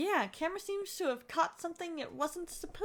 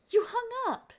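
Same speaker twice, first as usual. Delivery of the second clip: surprised; 10 semitones higher, 7 dB louder than usual. Acted. Character reacts to hang-up.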